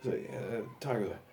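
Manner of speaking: normal talking voice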